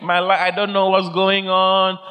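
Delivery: mock self-pitying moan; helpless complaining. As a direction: wail your worries on one high level note. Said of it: "don't talk like that".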